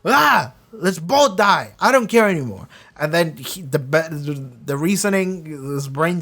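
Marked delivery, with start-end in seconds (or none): 0.0-2.7 angry despair. Retold or imitated. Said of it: "he's so angry that".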